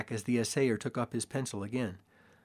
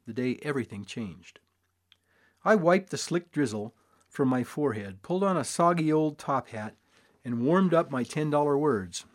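ordinary delivery, nothing marked

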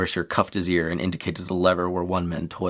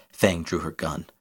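usual speaking voice

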